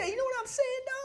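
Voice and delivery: high-pitched caricature voice; mock movie-character voice, very high; put-on caricature. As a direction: pitch it way up as a joke. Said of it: "don't do that".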